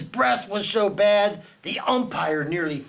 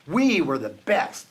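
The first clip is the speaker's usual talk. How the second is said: usual speaking voice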